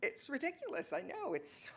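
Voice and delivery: ordinary talk, nothing marked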